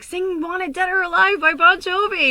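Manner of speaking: very high, loud mock crowd yell; shouted request. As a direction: holler it like a bar crowd demanding a song. Acts out bar crowd shouting requests.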